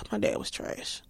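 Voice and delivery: whispered under her breath; breathy aside, barely voiced; muttered thought. Daughter muttering a bitter morning thought about her dad, whispered.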